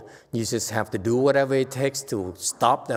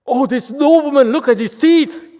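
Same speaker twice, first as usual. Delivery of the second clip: much higher, louder hammed-up admiration; mock gushing; 13 semitones higher, 11 dB louder than usual. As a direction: play up delight to draw them in. Mock gushing to engage someone.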